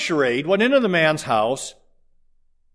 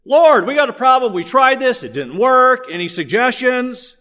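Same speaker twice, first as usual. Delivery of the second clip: higher and louder, hammed-up plea; mock complaint to the Lord; mock disciples; 7 semitones higher, 4 dB louder than usual. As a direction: play the sheepish disciples asking for help. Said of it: "disciples went up to Jesus and said".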